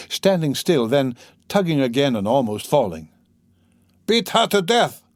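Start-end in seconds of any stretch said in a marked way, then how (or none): 4.1-5.0 put-on drunken angry cry. A narrator's reading. Drunk Mikolka urging cruelty, an angry shout. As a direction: egg the crowd on to beat her. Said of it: "cried Mikoka".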